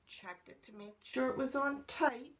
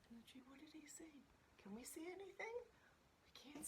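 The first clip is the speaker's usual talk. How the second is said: soft, higher-pitched half-whisper; hushed aside; 7 semitones higher, 19 dB quieter, much faster than usual. Real, not acted. Hushed aside to listeners about picture.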